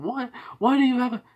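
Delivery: high, rushed mock yell. Sister yells at her brother in a story, high retold yell. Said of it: "she's just yelling".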